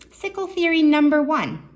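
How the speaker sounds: high, loud game-show heading; a big, high, loud reveal of the first theory; excited announcement. Acted. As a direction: announce it like a quiz-show host. Kids' show heading read out.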